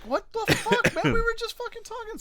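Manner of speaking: high-pitched, laughing mock outrage; exasperated protest. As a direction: squeal it in disbelief. Retelling his exasperated reply to brother.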